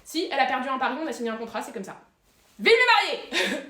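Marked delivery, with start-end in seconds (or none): 2.6-3.6 toast cheer. Acted. Toast at wedding speech.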